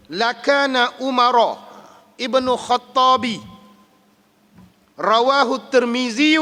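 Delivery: slow, measured Arabic recital of a hadith; hadith recitation. Acted. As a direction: intone the Arabic text slowly and reverently.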